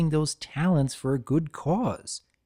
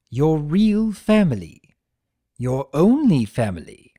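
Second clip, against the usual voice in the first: louder, firm trickster rebuke; Discord scolding; 2 semitones higher, 6 dB louder than usual. Acted. Discord confronting Tirek about his brother, firm and louder. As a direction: hammer the truth home to him.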